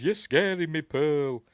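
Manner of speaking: high, loud mock-frightened protest; acted alarm. Projectionist protesting to an angry Pearl, acted loud alarm. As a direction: plead loudly in hammed-up alarm. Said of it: "He goes".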